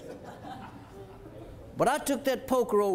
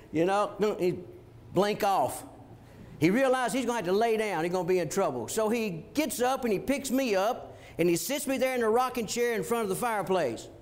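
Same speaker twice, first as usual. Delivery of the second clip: normal talking voice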